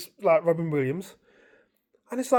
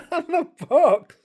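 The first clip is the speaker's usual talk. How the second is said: high laughing burst over the words; 10 semitones higher, 7 dB louder than usual. Real, not acted. Telling mates about the dream, laughing at the box head. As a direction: crack up mid-sentence at the silly box.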